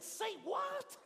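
high, loud "say what?" of shock; can't believe what he just heard; stunned disbelief. Retold or imitated. To the brother, shocked about a vampire bat.

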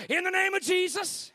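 high, loud pounce on the words; mock surprise blessing. Preacher ambushing family in the shower, sudden loud blessing.

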